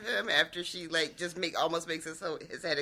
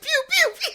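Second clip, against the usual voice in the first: very high, loud "pew" noises; imitated finger-gun shots; 7 dB louder than usual. Co-host imitating his finger guns, high pew noises. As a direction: fire off high cartoon gun sounds, nearly laughing. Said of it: "the finger guns really sell it".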